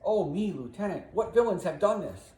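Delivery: high, loud theatrical dismay; feigned shock. Acted. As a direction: exclaim as if horrified. Iago feigns shock at Cassio's wounds.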